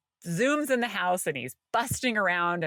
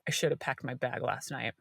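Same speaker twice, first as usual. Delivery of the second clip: sheepish son; 7 semitones lower, 6 dB quieter than usual. Retold or imitated. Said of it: "He's like".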